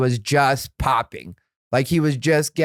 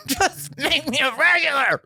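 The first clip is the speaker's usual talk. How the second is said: losing it; 11 semitones higher than usual. Retold or imitated. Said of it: "that time I really lost it".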